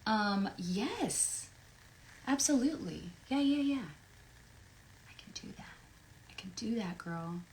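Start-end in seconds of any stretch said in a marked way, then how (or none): 5.1-5.7 breathy whisper to herself. Real, not acted. Promising a fan under her breath, whispered. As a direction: mouth it almost silently. Whispers it, then repeats it aloud.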